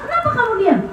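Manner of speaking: high-pitched mock exasperation; mildly acted annoyed questioning; retold angrier husband. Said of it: "Dia semakin marah".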